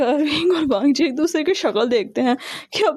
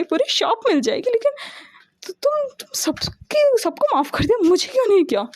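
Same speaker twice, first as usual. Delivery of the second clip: whiny pleading; 9 semitones higher than usual. A narrator's reading. Brother whines for forgiveness.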